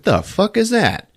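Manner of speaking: high, slow startled question. Retold or imitated. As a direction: ask it spooked and unsure. Startled heroine hears a noise.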